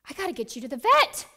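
loud, high and fast with panic; panicked rush. Retold or imitated. Dog owner panicking over her hurt puppy, loud and fast. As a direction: blurt it out in a frantic hurry. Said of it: "My first thought was".

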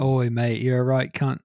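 lightly mocking British voice; imitated British accent. Co-host joining the joke about British court talk, mock British accent. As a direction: play at being a British lad. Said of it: "they talk like that".

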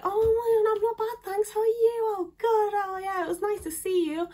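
very high, gushing politeness; put-on small-talk voice. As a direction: fake delight in a squeaky social voice. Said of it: "And I'll do the whole".

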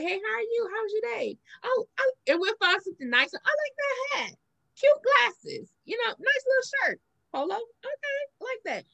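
high, sugary cordial chatter; sweet polite voice. Retold or imitated. Women being cordial to a man they don't like, high sweet mock politeness. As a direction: play the nice girl making small talk.

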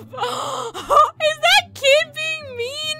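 high, slow, drawn-out shock; gasp, then squeal it slowly. Acted. Acted shocked reaction in skit.

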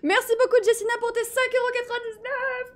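high, loud, giddy shriek; squealed thanks. Real, not acted. Streamer thanks a donor after alert scare, high squeal. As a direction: shriek your thanks, still jolted.

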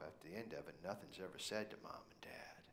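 whispered secret; conspiratorial whisper. Real, not acted. Preacher confiding the kids' secret to congregation, hushed whisper.

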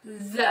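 "the" said with the tongue out between the teeth; proper "the". Shown as a demonstration. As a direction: push the tongue out for the "th" in "the". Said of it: "你的舌头要伸出来".

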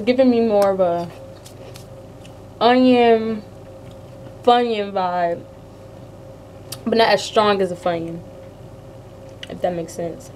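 speaking between bites; talking behind hand. Real, not acted. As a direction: hand over mouth. Seen 1: Hand repeatedly covers mouth while talking after eating chips; chewing unclear.

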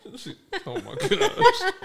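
high squeal of laughter through the words; words dissolving into a high laugh. Real, not acted. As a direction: lose it laughing while talking. Cracking up mid-sentence.

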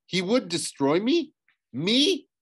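high, indignant questioning, rising further; outraged disbelief. Acted. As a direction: climb to a loud, incredulous last word. Thalia outraged at her father.

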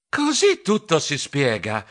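plain reading voice

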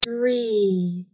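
usual speaking voice

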